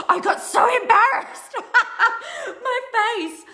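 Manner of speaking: high, loud embarrassed laughing; real laughter. Real, not acted. Telling viewers how embarrassed she got, laughing.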